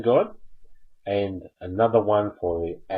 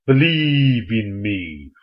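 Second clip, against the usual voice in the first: slow, louder, menacing god voice; 6 dB louder, much slower than usual. Pretend. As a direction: boom it out slowly like a threatening deity. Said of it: "look menacing like this".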